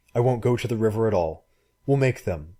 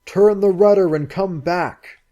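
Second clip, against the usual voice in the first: a loud, much higher shouted command; bellowing; 10 semitones higher, 8 dB louder than usual. Acted. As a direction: bellow it across the surf. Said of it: "he bellowed".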